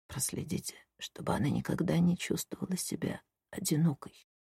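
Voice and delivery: faint breathy dying whisper; weak whisper. Acted. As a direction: whisper it with your last strength. Dying man's last request.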